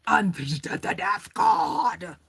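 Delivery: hammed-up movie-trailer read; dramatic announcer voice. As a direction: announce the card like an epic title. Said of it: "I don't know why I did it like that".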